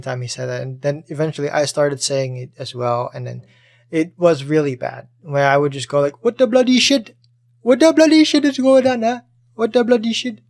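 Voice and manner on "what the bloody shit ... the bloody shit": put-on high, loud Indian accent. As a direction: repeat it in a mock Indian accent. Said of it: "with the, the Indian accent".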